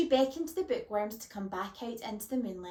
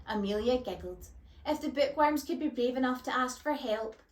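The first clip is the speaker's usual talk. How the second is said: ordinary delivery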